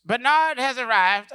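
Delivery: slow, loud, high proclamation; grand announcement. Retold or imitated. Cat at the hotel door, slow loud grand announcement.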